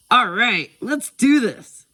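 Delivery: high, loud rallying cry; loud, eager kid's battle cry; excited yell. A narrator's reading. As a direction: yell it raring to go. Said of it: "Taika yelled excitedly".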